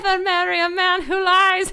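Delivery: high, loud Irish nanny voice; crazy Irish nanny. Retold or imitated. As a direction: wail it like a batty Irish nanny. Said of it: "the Irish lady".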